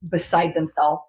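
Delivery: ordinary talk, nothing marked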